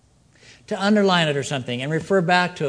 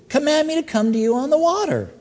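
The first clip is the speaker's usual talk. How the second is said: bold eager request; 10 semitones higher, faster than usual. Acted. Acting Peter's bold request to Jesus.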